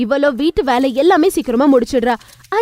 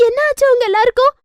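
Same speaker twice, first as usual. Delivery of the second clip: much higher, panicked question; sudden alarm; 9 semitones higher than usual. Acted. Wife sees the family fall sick, alarmed.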